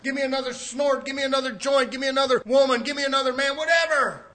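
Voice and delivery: loud, scornful mock of a greedy sinner; mocking sinner's demands. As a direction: spit out each demand with contempt.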